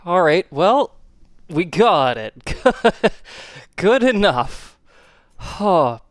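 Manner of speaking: ordinary talk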